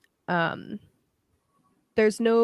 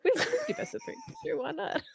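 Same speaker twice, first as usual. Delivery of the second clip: laughing; 10 dB quieter, faster than usual. Real, not acted. Repeats the offer, second laughing.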